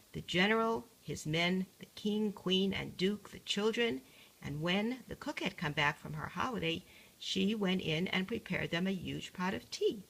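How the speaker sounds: ordinary delivery, nothing marked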